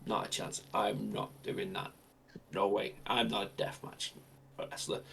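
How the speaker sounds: soft, dismissive no; quiet refusal. Retold or imitated. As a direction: brush it off under breath. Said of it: "John's like".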